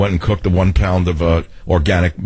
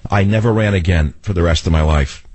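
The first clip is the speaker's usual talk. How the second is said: usual speaking voice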